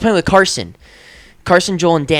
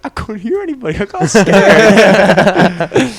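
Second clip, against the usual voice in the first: higher, laughing defensive whine; laughing plea; 5 semitones higher, 7 dB louder, slower than usual. Retold or imitated. Defending himself to friends, a higher laughing protest. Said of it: "And I was like".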